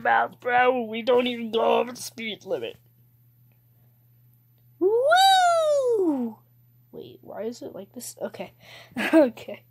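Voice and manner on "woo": very high, loud, drawn-out woo; excited whoop. Real, not acted. Player speeding past the limit, excited whoop. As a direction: squeal a long woo that falls away.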